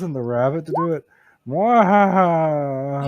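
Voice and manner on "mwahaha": slow evil villain laugh; drawn-out hammy cackle; sneering panto villain. Pretend. Gloating over sending the rabbit.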